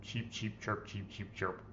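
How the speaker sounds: soft apologetic chirping; apologetic chick peeps. Pretend. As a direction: say sorry as a timid baby chick. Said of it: "I'm sorry" in chick language".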